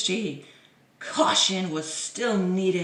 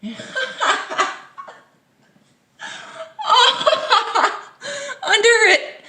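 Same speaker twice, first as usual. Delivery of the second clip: words broken by loud high laugh; 7 dB louder than usual. Real, not acted. Vlogger spotting the cat picture, laughing. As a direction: laugh through it.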